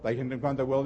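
quick, soft, slurred mumble of the prayer; rattled off by rote. Retold or imitated. Churchgoer praying on autopilot, mumbled quickly. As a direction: rush it like a half-heard habit. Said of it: "You just probably went".

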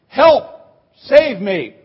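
sudden high, loud call of Help, easing on Save me; mock cry for help. Preacher acting out the psalm's cry, loud and urgent. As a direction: cry out like someone drowning. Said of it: "where it says".

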